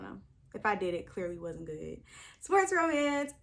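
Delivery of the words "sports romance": much higher, louder, thrilled shout of the trope name; excited shout. Real, not acted. Reader cheering the next trope to viewers, loud excited shout. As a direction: yell it out with joy. Announcing her favorite trope.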